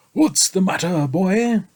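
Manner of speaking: gentle, kindly question; kindly inquiry. A narrator's reading. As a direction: ask kindly like a knight. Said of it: "he inquired kindly".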